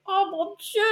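fading mock fright; mock horror. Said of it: "Après, tu fais".

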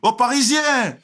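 high, loud imitated jeer; mocking Marseillais. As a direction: imitate locals calling out the outsider. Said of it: "On m'appelle Parigo".

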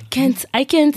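put-on American accent. Student repeating herself to baffled hosts, American accent. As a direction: repeat "can't" with an American short front vowel. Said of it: "mon accent, euh, je le calquais sur".

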